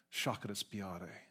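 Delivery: slow, soft "Shakespeare" as "shah-ka-spee-AH-tay"; Italian-sounding pronunciation. Retold or imitated. Foreign student naming his favorite writer, "Shakespeare" Italianized as "shah-ka-spee-AH-tay". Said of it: "That sounds Italian".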